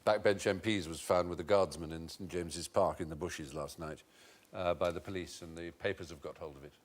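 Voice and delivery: low, hushed, discreet report; lightly voiced aide breaking awkward news; aide briefing Churchill. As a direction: keep it soft and low, deferential. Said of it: "And he said".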